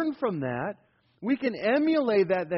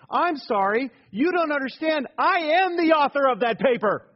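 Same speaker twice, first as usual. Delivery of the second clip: higher, louder retelling of a shout; retold shout; 8 semitones higher, 6 dB louder than usual. Pastor telling the presenter he wrote the paper, shouting. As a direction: bark the punchline back at her. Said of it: "the pastor shouted back".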